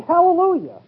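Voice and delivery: a high, glad Hallelujah bursting with praise; joyful shout. Retold or imitated. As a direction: shout it out like Paul overflowing with praise. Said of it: "yelling and shouting".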